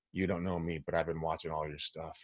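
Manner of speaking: low, soft, creepy stranger voice; hammed-up creepy admirer, low and hushed; creepy Silence of the Lambs voice. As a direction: murmur it like a serial killer fan. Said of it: "so you don't sound like so much like Silence of the Lambs".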